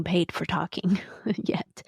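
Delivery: a laughing whisper, almost to herself; whispered aside. Real, not acted. Host confides to listeners, laughing whisper. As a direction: let the joke slip out under your breath. Joking aside about unpaid podcast.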